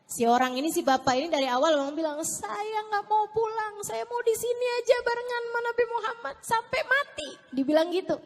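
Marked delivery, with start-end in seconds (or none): 2.2-7.3 pleading refusal. Retold or imitated. Old man insisting on staying till death, high pleading voice. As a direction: insist in a high, wailing imitation. Retells old man's stubborn plea.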